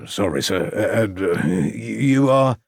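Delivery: hesitant, halting, stumbling voice; stammering constable. A narrator's reading. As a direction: fumble the words nervously. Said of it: "stammering constable".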